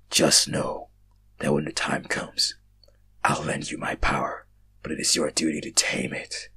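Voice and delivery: low, slow, breathy whisper of a hidden being; deep whisper. Acted. Mysterious voice warns the dreamer, low whisper. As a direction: menace them from the dark in a low whisper. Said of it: "the voice said".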